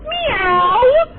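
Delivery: very high, loud drawn-out meow; yelled meow. Retold or imitated. Player showing the team's cat cheer, yelled meow. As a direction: belt out a long cat cry. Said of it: "I'm yelling meow very loud".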